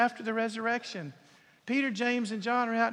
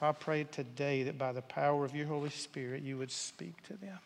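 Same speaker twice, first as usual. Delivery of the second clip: closing prayer; 4 semitones lower than usual. Real, not acted. Preacher leads closing prayer.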